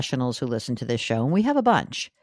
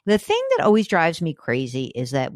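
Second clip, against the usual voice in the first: ordinary talk, nothing marked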